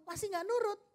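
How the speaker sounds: high-pitched parental warning; rising parental intonation. Acted. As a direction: pitch climbs as patience runs out. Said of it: "Intonasinya naik".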